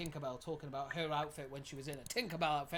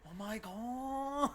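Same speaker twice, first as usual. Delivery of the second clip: high, slow, gushing oh my god; excited squeal; 9 semitones higher, much slower than usual. Real, not acted. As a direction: squeal it with delight. Romance reply in game, squealing.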